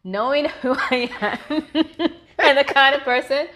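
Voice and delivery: laughing loudly at herself. Real, not acted.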